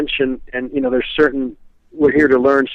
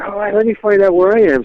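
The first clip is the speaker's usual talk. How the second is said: imitating an eager brother, pitched up; lightly acted excited outburst; retold excitement; 7 semitones higher than usual. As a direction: quote the brother's eagerness in a higher voice. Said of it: "his brother was all excited".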